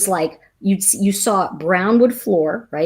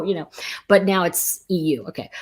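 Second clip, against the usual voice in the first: ordinary talk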